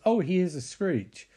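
usual speaking voice

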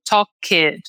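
"talk it" as "talk-ED", stressed added syllable; Brazilian learner error. Shown as a demonstration. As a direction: punch a full extra "ed" at the end of "talk it". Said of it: "enfatizar sempre esse E D do final". ("talk it" com o "ED" final forte, erro de brasileiro)

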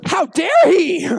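high, loud, drawn-out indignation; hammed-up affronted outburst; mock outrage. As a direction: act scandalized, stretching each word. Acts out an ignored teen's outrage.

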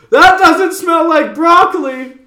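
high, slow shocked shout; mother shouting. Retold or imitated. Mother shouting down at the basement, high and loud. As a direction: yell it up the stairs. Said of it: "and we hear".

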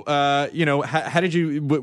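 ordinary talk

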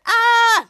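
high, loud mock fight yell; a short startled cry mid-scuffle; cartoon fight yelp. Schwoz and Toddler fighting.

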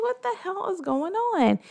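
high-pitched, baffled exclamation; puzzled, voice lifted high; retold bewilderment. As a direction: wonder aloud in mock confusion. Retells her confused reaction.

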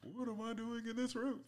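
high, whiny Jerry Seinfeld bit; bad Seinfeld impression, squeaky high. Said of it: "That is the worst Jerry Seinfeld impression".

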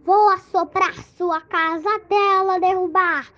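loud, growly threatening wolf; big bad wolf voice. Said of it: "aí ele falou". (voz de lobo mau ameaçador)

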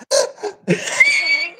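high-pitched imitated crying howl; hammed-up burst of bawling; mock wail. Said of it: "literally said she did this".

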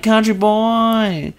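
slow imitated Vine girl. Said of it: "It's like".

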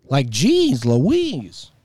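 drawn-out, swooping exclamation; over-the-top exasperation; exasperated disbelief. Real, not acted. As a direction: stretch each word in comic dismay. Exasperated close of the rant.

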